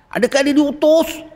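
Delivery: high, sharp suspicious question; harsh gatekeeper. Retold or imitated. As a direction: interrogate like a stern guard. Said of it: "Abi garangnya". (nada garang)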